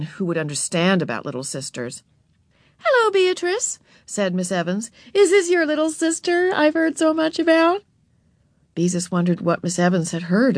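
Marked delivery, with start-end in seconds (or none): 2.8-3.8 high, friendly librarian voice; librarian greeting. A narrator's reading. Miss Evans greeting Beezus at the library, high and friendly. 5.1-7.8 high, friendly questioning voice; librarian greeting. A narrator's reading. Miss Evans asking Beezus about Ramona, high and friendly.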